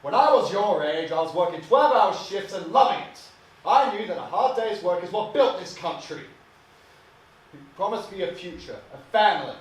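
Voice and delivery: loud, high-pitched tirade; heated parental dressing-down at full volume; angry father berating son. Acted. Father berating his son, acted.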